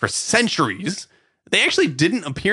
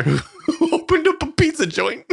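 usual speaking voice

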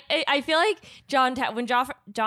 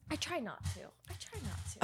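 much softer, barely voiced aside; near whisper; 2 semitones higher, 18 dB quieter, faster than usual. Real, not acted. Hushed aside while someone nearby misbehaves, near whisper. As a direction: drop to a hush so no one hears. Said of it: "quiet aside during recording".

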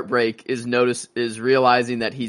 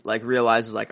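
ordinary talk, nothing marked

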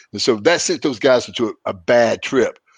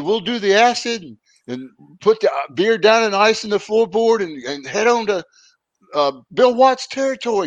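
imitating the Sheik; 9 semitones higher than usual. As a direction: play the stoned Sheik hyping the big man. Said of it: "He said".

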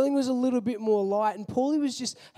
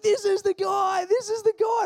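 much higher, louder tipsy shout; drunk excited shout; 12 semitones higher, 4 dB louder than usual. Retold or imitated. Tipsy guest shouting to girlfriend, much higher and loud. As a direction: call his girlfriend over, thrilled. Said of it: "he's like".

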